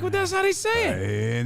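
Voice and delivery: high-pitched indignant self-defense; exaggerated defensive whine; mock protest. Mock protest to his daughter.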